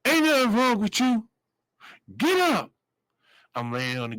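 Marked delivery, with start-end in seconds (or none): 0.1-2.7 high, sharp commands; barking sergeant. Retold or imitated. Sergeant standing over the inmate, barking orders. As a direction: order him up like a fed-up sergeant. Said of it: "He said".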